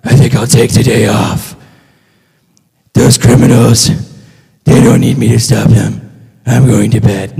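low, husky superhero voice; Batman. Acted. Hero excusing himself from fighting crime, low gruff impression. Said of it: "Batman's never like".

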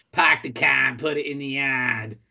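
hammed-up Boston accent, pahk the cah; a mock Mark Wahlberg Boston accent. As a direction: lay on the thick Boston vowels. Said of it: "I hear a lot of Mark Wahlberg in there".

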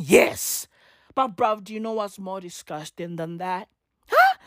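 ordinary talk

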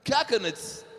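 "Coconuts" said high and firm; repeated emphatically. Shown as a demonstration. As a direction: hammer the word "Coconuts" home. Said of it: "Hã?".

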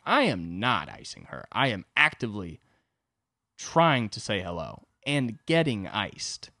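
slow, stressed I am not; emphatic denial. Retold or imitated. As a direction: insist word by word. Insisting to friend.

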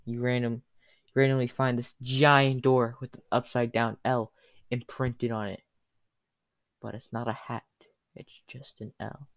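normal talking voice

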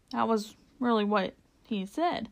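plain reading voice

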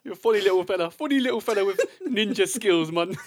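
high, loud Geordie Alan Shearer impression; lilting, hammed-up Geordie; put-on Geordie footballer voice; Geordie accent. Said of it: "That's how good my accent is".

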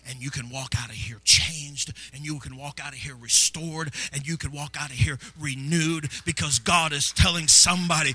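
impassioned preaching. Real, not acted. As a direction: drive each promise harder until it rings out. Preaching builds to full force.

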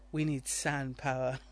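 slow, low and soft mock pronouncement; drawn-out deadpan quote. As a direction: drop it low and slow, like a weighty slogan. Mock movie line, deadpan.

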